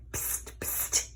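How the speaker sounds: unvoiced spitting psst; spitting hiss. A narrator's reading. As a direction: spit it out like an angry lizard. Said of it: "he spat".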